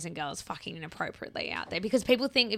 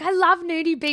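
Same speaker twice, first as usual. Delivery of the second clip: higher, louder, gushing mock enthusiasm; gushing excitedly; 10 semitones higher, 7 dB louder than usual. Retelling friend's eager excitement about nudie beaches, gushing. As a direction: play up the friend's naive excitement. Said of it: "You're like".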